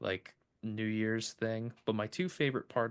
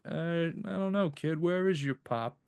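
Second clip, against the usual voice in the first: gruff Santa cop; 7 semitones higher than usual. Retold or imitated. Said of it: "And he's like".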